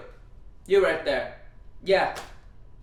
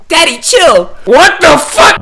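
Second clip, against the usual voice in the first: shocked; 6 semitones higher, 5 dB louder than usual. Real, not acted. Shocked at misread name.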